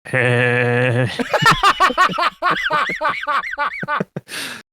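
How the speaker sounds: high cackling witch laugh; old hag. Pretend. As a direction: cackle loud like a wicked witch. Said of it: "give me a good witch laugh".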